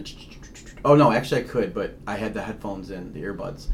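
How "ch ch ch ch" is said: quick voiceless "ch" bursts; imitated radio static. Describing headphones on a scanning radio, imitated static. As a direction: chop out soft static clicks with the mouth. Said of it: "I'm just hearing the".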